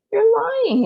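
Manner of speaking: high, loud outraged cry; a boy crying out in disbelief; indignant cry. Acted. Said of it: "he cried".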